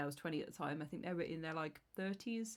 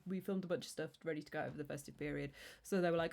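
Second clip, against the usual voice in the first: ordinary talk, nothing marked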